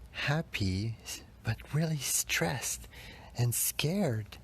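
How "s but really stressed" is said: breathy whispered confession, pitch lifted. Real, not acted. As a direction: whisper it as if admitting a secret. Confessing childhood stress and fear.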